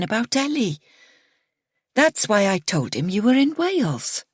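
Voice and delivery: mother's voice. A narrator's reading. Mother's voice in story.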